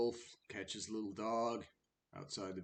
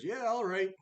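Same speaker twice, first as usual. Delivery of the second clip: high, lightly hammed-up consent; agreeing wolf; 12 semitones higher, 10 dB louder than usual. Wolf letting the little dog go, acted grudge-free consent. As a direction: give in with a high, loud lilt.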